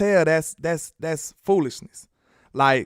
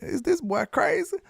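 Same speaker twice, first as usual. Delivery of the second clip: put-on high, soft "is this boy crazy"; airy high mocking question; incredulous; 11 semitones higher, 4 dB quieter than usual. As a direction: ask it high in mock disbelief. Mocking disbelief.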